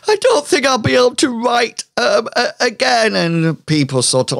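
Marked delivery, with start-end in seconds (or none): 0.1-3.1 high, fast melodramatic whine; milk the injury; high hammed-up victim; fake victim lament.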